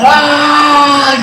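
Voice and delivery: long, high held "aah" battle cry; mock warrior scream on one sustained note; drawn-out charging yell at moderate volume; imitated war yell. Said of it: "Imagínate gritando".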